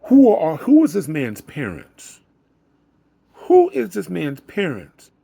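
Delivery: high, loud amazed exclaiming. Real, not acted. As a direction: exclaim in disbelief. Amazed outburst about the boy.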